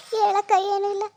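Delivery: high, upset reply on the verge of crying; tearful. Acted. Pooja answering her elder sister on the phone, tearful. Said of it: "ನಾವು ಬೇಜಾರ್ ಮಾಡ್ಕೊತೀವಿ".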